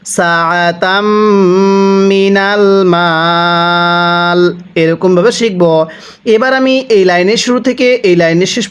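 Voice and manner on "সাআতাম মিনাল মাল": drawn-out chanted recitation; slow Quran recitation. Shown as a demonstration. Teacher recites for students to copy, slow chant. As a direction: stretch the vowels and hum the nasal. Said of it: "কিভাবে পড়ব?".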